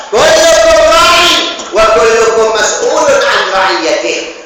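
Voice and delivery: loud, high, ringing preacher's voice; full-force sermon delivery; forceful preaching. Acted. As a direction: proclaim the verse to the whole hall. Preacher proclaims the hadith.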